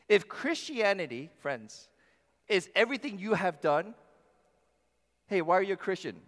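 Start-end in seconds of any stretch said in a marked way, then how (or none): none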